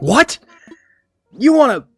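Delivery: loud high outraged disbelief; stern dictator. Retold or imitated. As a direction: sneer at the spammer. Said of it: "I was like".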